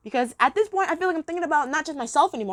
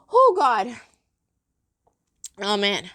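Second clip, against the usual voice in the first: ordinary talk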